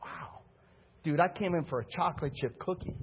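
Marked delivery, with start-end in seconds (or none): 0.0-0.4 breathless whispered wow; stunned whisper. Retold or imitated. Pastor stunned by the cookie shop question, whispered. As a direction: let the wow fall out in a hush. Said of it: "I was like".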